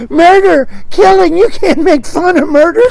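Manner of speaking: high, loud scandalised complainer; gasp-worthy hammed-up outrage; mock horror. Objector appalled at fun made of murder, mock horror.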